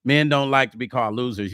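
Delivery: ordinary talk, nothing marked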